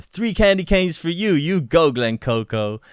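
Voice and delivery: high, loud quote with a flourish; movie quote. Acted. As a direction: call it out like a film line. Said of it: "my favorite one is, like, the".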